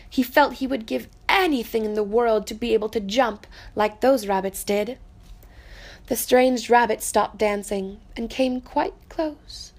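ordinary delivery

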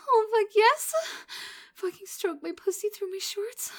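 building from eager moans to loud, high cries; rising aroused moans. Acted. Lover nearing the edge, building to loud high cries.

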